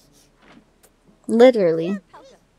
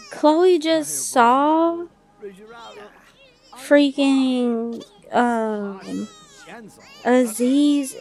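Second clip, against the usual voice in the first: drawn-out shock; 2 semitones higher, much slower than usual. Real, not acted.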